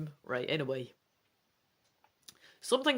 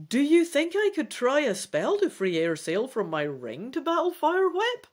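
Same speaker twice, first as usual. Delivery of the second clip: desperate; 9 semitones higher, 5 dB louder than usual. A narrator's reading. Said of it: "you ask Rhinath desperately".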